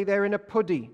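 puddy with an oo sound, like pudding; saying puddy. Retold or imitated. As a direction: say puddy rhyming with good. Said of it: "Do we say that in this part? Or a paddy?".

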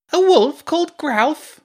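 very high, loud, slow boom; booming Hugo. Acted. Hugo butting into the friends' talk, booming. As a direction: boom it out like a loud know-it-all kid. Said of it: "Hugo boomed".

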